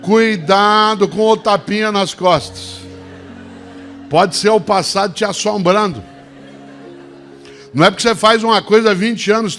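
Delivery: booming motivational speech. Acted. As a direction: drive the lesson home with force. Speaker turns from joke to message.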